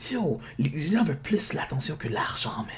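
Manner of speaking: unvoiced confiding whisper. Real, not acted. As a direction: lean in and whisper it. Whispered aside to listeners. (en chuchotant)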